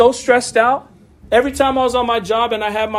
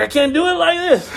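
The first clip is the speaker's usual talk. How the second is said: higher, frazzled voice with a laugh; stressed whine; 7 semitones higher than usual. Retold or imitated. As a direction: replay the panic of a broken computer. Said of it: "I was stressed out".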